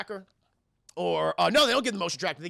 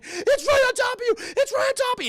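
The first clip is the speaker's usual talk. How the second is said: very high, loud imitated shriek; screaming warning; 6 dB louder than usual. Crewmate screaming to captain that the alien is near, shrieking. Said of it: "she's just fucking screaming".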